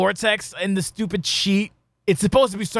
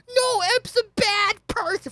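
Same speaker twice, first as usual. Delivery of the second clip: much higher, slow sarcastic whine; mocking fan voice; slower than usual. Mocking fickle fans who turned on Emp, high and slow. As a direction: drag it out like a hypocrite.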